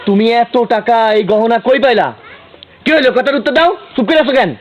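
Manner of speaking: high, agitated questioning. Acted. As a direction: pitch climbs as the answers are demanded. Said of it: "এত চিল্লাইতেছো কেন".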